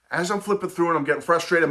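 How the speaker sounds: usual speaking voice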